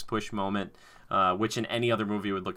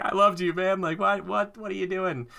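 anguished plea; 9 semitones higher than usual. Retold or imitated. Obi pleading with Anakin as he breaks down, anguished. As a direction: cry out to a lost friend, a mild take. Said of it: "it's like".

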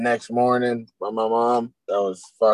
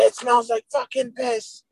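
high imitated mother voice; scolding mom; 14 semitones higher than usual. Mom interrogating son next morning, high scolding. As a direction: scold in a mock mom falsetto.

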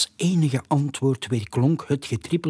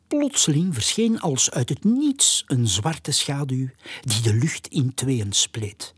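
ordinary delivery

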